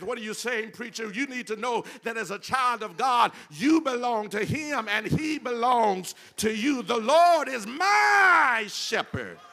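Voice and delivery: full-force preaching. Acted. Preacher telling believers God is theirs, climactic shouted preaching. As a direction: rise to a ringing preacher's shout.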